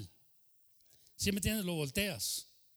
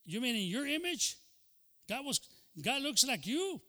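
higher, mock disbelieving retort; incredulous TV character; 8 semitones higher, 3 dB louder than usual. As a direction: needle Archie with a disbelieving question. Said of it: "el negrito, dice".

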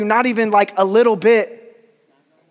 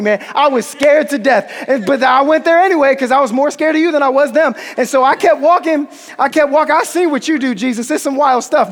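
geeked disciples. Acted. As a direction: burst in to tell Jesus everything, laughing. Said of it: "they're geeked ... They're excited".

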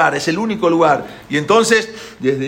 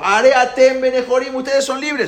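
high, raised proclamation; shouting; 9 semitones higher, 6 dB louder than usual. Acted. Pharaoh shouts that the slaves are free, shouting. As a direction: shout the release to everyone. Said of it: "a gritar Paró".

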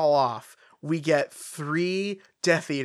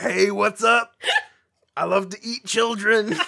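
mock werewolf; 6 semitones higher, 3 dB louder than usual. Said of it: "comes in and is like".

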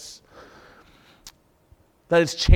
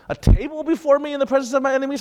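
higher and fast, baffled question; incredulous quote; 7 semitones higher, faster than usual. Shown as a demonstration. Preacher questioning the verse to his congregation, incredulous. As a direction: say it like it makes no sense. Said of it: "How does that make any sense?".

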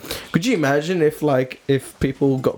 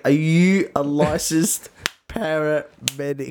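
slow, word-by-word judge's question; insistent judge; slower than usual. Pretend. Judge repeating the question to the rescuer, pointedly slow. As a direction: spell it out slowly, pressing the point. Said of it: "and he's like".

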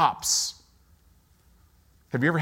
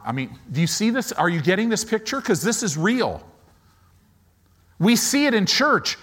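ordinary delivery

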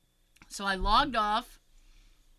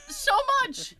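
rising, louder, pleading so much; mock exasperated wail; 13 semitones higher, 5 dB louder than usual. Complaining about mom to friends, mock exasperated wail. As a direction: wail it in retold frustration.

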